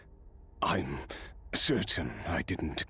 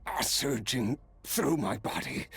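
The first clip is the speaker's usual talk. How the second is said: pained; 9 semitones higher than usual. Acted. Power overwhelming him, in pain.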